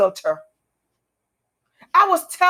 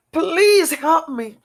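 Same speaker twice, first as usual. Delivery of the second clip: slow pleading prayer; 4 semitones higher, 3 dB louder, much slower than usual. Retold or imitated. Praying to God at seventeen.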